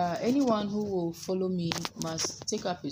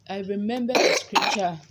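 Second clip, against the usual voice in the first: loud; 4 semitones higher, 10 dB louder than usual. Real, not acted.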